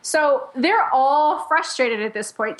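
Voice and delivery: usual speaking voice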